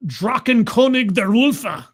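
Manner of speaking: loud, slow fake German accent. Shown as a demonstration. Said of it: "I'm doing my best fake German pronunciation".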